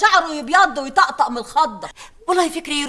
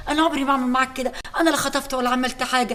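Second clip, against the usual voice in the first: ordinary talk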